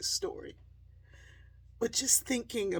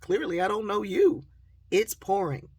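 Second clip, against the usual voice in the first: loud, raised-pitch comeback; louder, higher, putting them straight; exasperated retort; 5 semitones higher, 8 dB louder than usual. Retold or imitated. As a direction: re-enact an insistent answer to a friend. Retells arguing back to friend.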